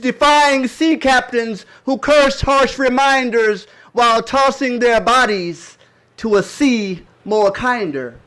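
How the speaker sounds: loud, high, slow declamation; impassioned poem recitation. Acted. Poet reciting grandparents' Middle Passage sorrow, loud impassioned declamation. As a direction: ring out each phrase with drawn-out stresses.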